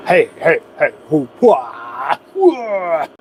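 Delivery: string of heys into drawn-out whoops; "hey, hey" breaking into long "hua" cries; playful whooping. Pretend. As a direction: toss out silly drawn-out hoots. Playful noises after reading a name.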